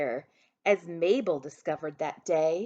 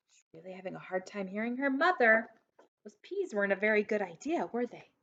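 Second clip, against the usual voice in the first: quick aside; faster than usual. Real, not acted. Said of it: "reader aside to children".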